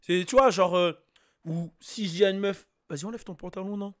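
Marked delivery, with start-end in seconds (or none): 2.9-3.9 much softer, casual coaxing; cute and harmless. Shown as a demonstration. Speaker to a girl in his own voice, soft and cute. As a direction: say it small and soft, as a mild suggestion. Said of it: "c'est mignon".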